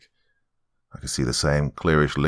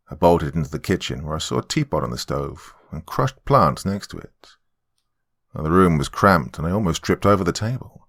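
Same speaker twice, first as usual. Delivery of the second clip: ordinary delivery, nothing marked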